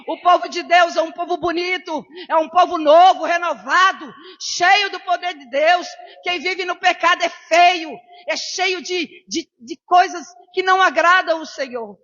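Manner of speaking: loud, high, ringing sermon cry; full-force preaching. Acted. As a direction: preach it out over the crowd. (pregação inflamada e alta)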